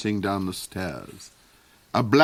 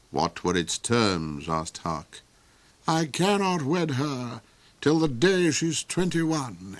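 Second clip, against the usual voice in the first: a higher, brooding villain; the Duke's voice, lightly raised; 5 semitones higher than usual. Acted. The Duke explains the witch's spell to Hark, higher and brooding.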